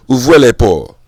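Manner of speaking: loud French call to open up; French accent. Retold or imitated. Telling coworker to open the gates, loud French accent. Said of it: "with an amazing accent".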